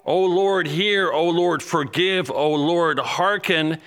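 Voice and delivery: preacher voicing Daniel crying to the Lord; crying out in prayer. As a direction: cry out each plea to God. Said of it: "he's crying out to God".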